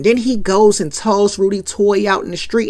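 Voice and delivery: ordinary talk, nothing marked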